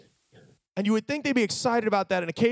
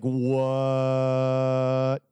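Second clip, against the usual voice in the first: amazed what; 6 semitones lower than usual. Retold or imitated. Amazed at water from rock.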